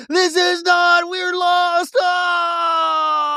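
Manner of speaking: high, frantic cry of being lost; panicked cry. Retold or imitated. People lost in the woods, crying out in hammed-up panic. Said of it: "people are like".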